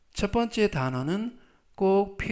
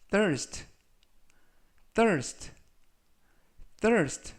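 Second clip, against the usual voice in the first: higher, careful "thirst" with a falling end; slow model reading; 4 semitones higher, much slower than usual. Shown as a demonstration. Teacher modelling a word for students, slow and careful. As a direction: say "thirst" slowly, pitched up. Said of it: "천천히 세 번씩 읽어드리고요".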